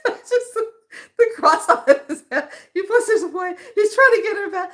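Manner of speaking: can barely get the words out for laughing; breathless amused recounting; laughing through it. Real, not acted. Describing scene while laughing.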